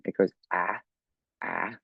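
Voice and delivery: ah, a short open vowel; short a sound. Shown as a demonstration. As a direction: sound out ah clearly. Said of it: "the short vowel sound A".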